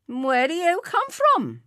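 high, loud official challenge; officious policeman voice. A narrator's reading. Policeman questioning drinkers caught after hours, officious. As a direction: demand it like a village constable. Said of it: "in his buttoned blue voice".

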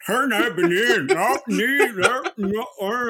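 slow, mumbling mock of a mouth full of gobstopper; Susie with a sweet in her mouth. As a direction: imitate a friend talking around a sweet. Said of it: "I was like".

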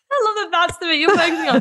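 bursting into high laughter mid-sentence; laughing. Real, not acted. Guest laughing at the host's breakfast question, cracking up. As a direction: laugh through the words, amused and caught off guard.

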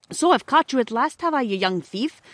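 high, loud accusation; stern station master. A narrator's reading. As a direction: pounce on the culprit. Station master catches Peter stealing.

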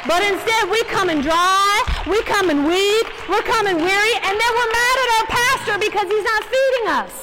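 loud, rising preacher's build; building sermon climax; preaching at full force. Acted. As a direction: hammer each line home to the room.

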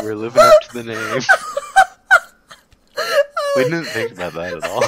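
fast, high, crying with laughter; words squeezed out between laughs; laughing hard. Real, not acted. Laughing fit at own mistake.

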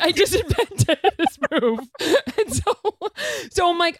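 high, helpless laughing through the words; real laughter. Real, not acted. Storyteller teased about her invented move, laughing helplessly. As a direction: fight to keep talking while cracking up. Cracking up over own story.

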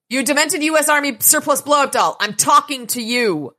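high, loud taunting yell; hollering insult. Acted. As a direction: yell to get her attention. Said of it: "he starts hollering at Barbie".

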